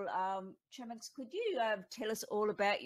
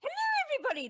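high, loud YouTuber greeting; over-the-top YouTube intro voice; 6 dB louder, much faster than usual. Shown as a demonstration. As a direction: blast a hammed-up YouTuber hello, pitched way up. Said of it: "I won't use my YouTube voice".

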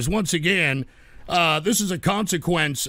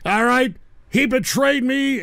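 usual speaking voice

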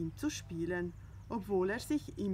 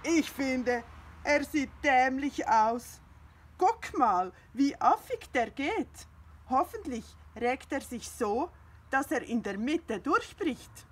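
high, loud, offended little cat; indignant cat Findus; 4 semitones higher, 6 dB louder than usual. Acted. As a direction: scoff at the very idea of a rooster. Findus mocks the rooster.

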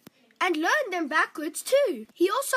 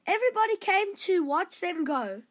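ordinary delivery

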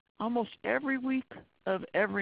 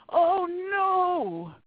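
much higher, louder alarmed cry; shocked cry; 10 semitones higher, 8 dB louder than usual. Pretend. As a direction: cry out in horror at the danger. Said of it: "I hope by the end of this lecture you'll say".

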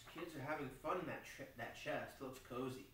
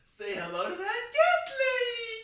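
much higher, much louder squeal again in the same way; squealing shout; 14 dB louder than usual. Real, not acted. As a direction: squeal it at the top of your lungs. Repeats the squealed line.

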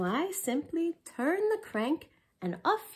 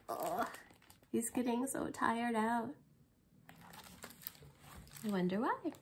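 plain reading voice

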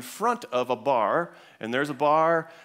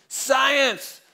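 put-on high, slow, bellowing drunk voice; drunk guy shouting; 10 semitones higher, 8 dB louder, much slower than usual. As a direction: bark it out like a drunk who thinks he has won. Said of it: "Talking like that".

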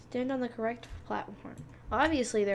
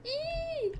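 high squeal; 3 dB quieter than usual. Real, not acted. Squeals as characters die.

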